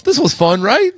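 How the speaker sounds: forced cheerful quote. Pretend. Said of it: "trying to tell yourself".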